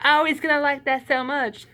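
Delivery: high, hammed-up Southern twang imitating the wife; a badly faked Southern accent, pitched high. Wife admiring the paper toy, in a bad high Southern twang. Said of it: "that was a bad Southern twang".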